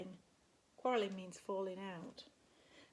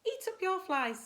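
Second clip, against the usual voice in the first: high, louder motherly order; bossy mum; 9 semitones higher, 6 dB louder than usual. Acted. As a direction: tell the little ones to eat up. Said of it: "said Mum".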